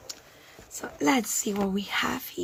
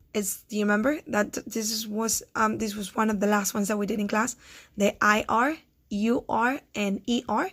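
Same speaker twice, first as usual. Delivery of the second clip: usual speaking voice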